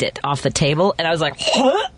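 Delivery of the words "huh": high-pitched, startled "huh?"; mock gasp of disbelief, pitched way up; shocked reaction. Retells shock at licked eggnog.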